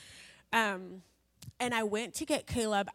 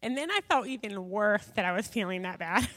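usual speaking voice

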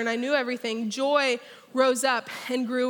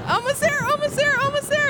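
almost there, much higher and louder; excited build-up; 13 semitones higher, 4 dB louder than usual. Real, not acted. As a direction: ride the tension up, teasing the crowd. Building suspense on the ride.

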